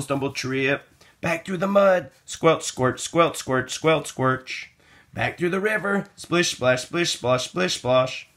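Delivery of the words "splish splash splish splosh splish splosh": usual speaking voice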